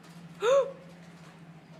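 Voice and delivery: sharp rising gasp; a frightened gasp; high gasp. Real, not acted. Gasping in fright as the bird darts away.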